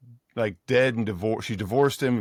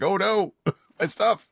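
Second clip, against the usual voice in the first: mock panic; 9 semitones higher than usual. Scientist panicking over his glass tubes, high mock alarm. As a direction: wail for the fragile gear, high. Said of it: "he's like".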